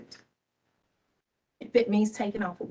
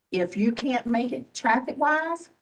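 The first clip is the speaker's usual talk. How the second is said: normal talking voice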